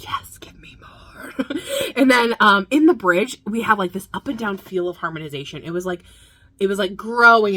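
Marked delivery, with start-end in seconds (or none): 0.0-1.3 slow, breathy whisper of craving; slow hushed plea for more. Retold or imitated. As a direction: whisper it like a secret indulgence. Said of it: "Every single time I'm just like".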